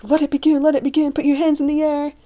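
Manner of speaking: high, excited imitation of screaming friends; mock screaming. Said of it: "hearing my friends screaming".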